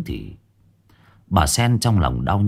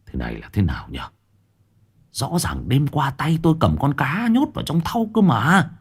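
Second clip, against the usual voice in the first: stammering husband; 6 semitones higher than usual. Acted. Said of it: "lắp bắp".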